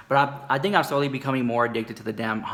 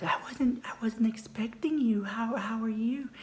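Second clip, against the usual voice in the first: mock whisper; 9 semitones higher, 7 dB quieter than usual. Caught off guard at door.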